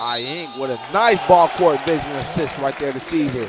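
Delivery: loud high shout calling the assist; fired-up commentator shouting the score; excited play call. Real, not acted. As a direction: belt out the play as it finishes.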